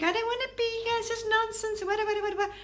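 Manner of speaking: high, mocking whine of someone fed up; mock sulky complainer. Mocking viewers who grumble about being here, high whiny voice. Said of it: "as much as you think".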